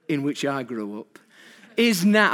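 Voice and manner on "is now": loud, higher northern-accent delivery. Shown as a demonstration. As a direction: put on a northern English accent. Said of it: "I do a northern accent".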